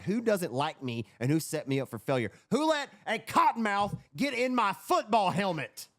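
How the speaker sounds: building to a loud, high, slowed-down demand; hammed-up interrogation of teammates; mock indignant questioning. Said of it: "start asking questions to be like".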